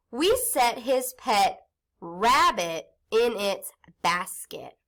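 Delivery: slow, careful sentence reading; reading aloud slowly. Shown as a demonstration. Teacher reading practice sentence to class, slowly. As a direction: read each word clearly for young listeners. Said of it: "Listen as I read the sentence".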